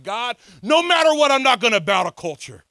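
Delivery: high, loud defiant cry; ringing declaration of resolve; defiant vow. Retold or imitated. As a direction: vow it fiercely. Retells his defiant vow.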